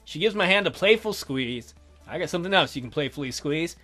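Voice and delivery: ordinary delivery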